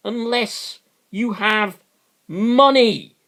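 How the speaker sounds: angry rant. Real, not acted. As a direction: hammer home each line, slowing on the big ones. Rant about privatised health service.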